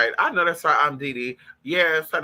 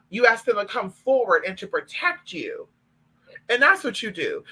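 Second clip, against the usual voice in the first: ordinary talk, nothing marked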